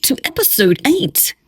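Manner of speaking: high bright radio host greeting. Pretend. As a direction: announce it like a radio DJ. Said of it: "best radio voice".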